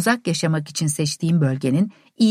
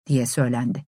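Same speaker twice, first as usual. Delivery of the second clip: ordinary delivery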